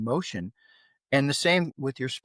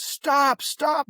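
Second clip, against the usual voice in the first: much higher-pitched urgent plea; imitating alarmed wife; 12 semitones higher, 3 dB louder than usual. Dad imitating his wife stepping in, urgent. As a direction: mimic her high cries to break it up. Wife breaks up father-son fight.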